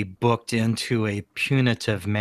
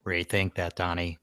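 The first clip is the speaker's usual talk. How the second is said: quiet menace; 3 semitones lower, 8 dB quieter than usual. Acted. Character stares down boss.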